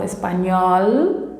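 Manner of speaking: "espanhol" ending on a clear tongue-tip L, rising; curled final L. Shown as a demonstration. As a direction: model "espanhol" with the L fully sounded. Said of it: "eu tenho que fazer essa volta". ("espanhol" com L final pronunciado com a língua)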